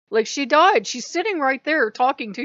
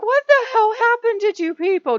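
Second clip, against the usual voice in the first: high, loud mock shock; incredulous; 13 semitones higher, 7 dB louder than usual. Viewer asking gurus what happened, incredulous. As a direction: pitch it way up, appalled and loud. Acted reaction to the guru.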